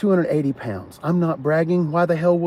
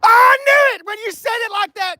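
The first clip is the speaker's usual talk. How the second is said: high, loud excited yell; excited shout; 7 dB louder than usual. Real, not acted. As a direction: burst out when the guess lands. Excited guess confirmed.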